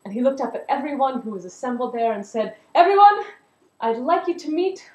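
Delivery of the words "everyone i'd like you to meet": proud announcement. Acted. Tune announces to the crowd.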